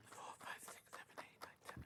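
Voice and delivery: counting in a whisper. Real, not acted. Host counting items in a whisper. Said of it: "counting under his breath".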